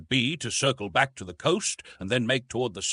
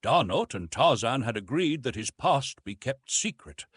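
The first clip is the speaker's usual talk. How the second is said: ordinary delivery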